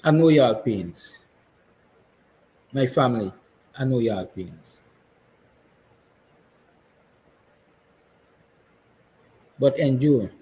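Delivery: getting emotional. Real, not acted. Father to his children, voice thick with emotion. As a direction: speak to your family through welling emotion. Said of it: "I'm getting emotional".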